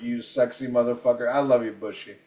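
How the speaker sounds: normal talking voice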